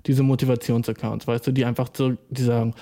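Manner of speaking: ordinary delivery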